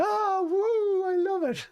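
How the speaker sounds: a real, soaring cheer; excited whoop. Real, not acted. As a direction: whoop with joy at the score. Celebrating three nil at halftime.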